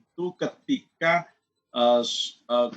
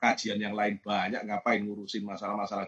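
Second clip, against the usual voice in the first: low, quiet dismissive grumble to himself; 7 semitones lower, 7 dB quieter than usual. Retold or imitated. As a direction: recall a scoffing thought, kept low and soft. Voices his former dismissive thought.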